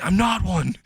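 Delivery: high mock-outraged protest; indignant denial. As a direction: deny it in a high, exasperated cry. Indignant denial to accusing crowd.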